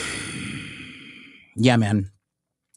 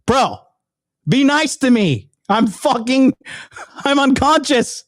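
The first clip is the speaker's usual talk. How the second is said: much higher, hammed-up plea to the doctor; mock pleading; 12 semitones higher, slower than usual. Retelling watching the footage, pleading with the surgeon in mock distress. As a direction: beg the doctor in fake distress. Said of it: "I was like".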